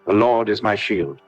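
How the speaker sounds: ordinary talk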